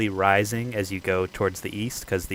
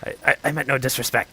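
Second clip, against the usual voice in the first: stammering kobold; 4 semitones higher, faster than usual. Acted. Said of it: "he's like".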